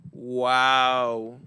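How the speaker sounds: deadpan, no excitement; flat monotone wow. Pretend. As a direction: say wow with zero emotion. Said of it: "hard time reading and showing emotions".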